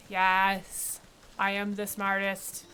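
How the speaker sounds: loud, drawn-out self-congratulation; triumphant. Real, not acted. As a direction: crow over a small victory, slow and loud. Player celebrates finding the swamp.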